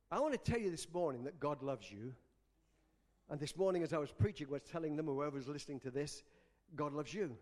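low, soft, sincere preaching; tender reassurance. Real, not acted. Preacher telling listeners they are loved, tender reassurance. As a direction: drop right down and assure them gently.

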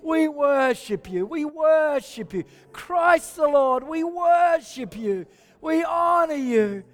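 high, slow, sung-out praise; worship praise. Real, not acted. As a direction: lift it up in adoration.

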